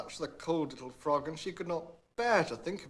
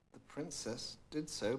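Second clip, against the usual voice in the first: plain reading voice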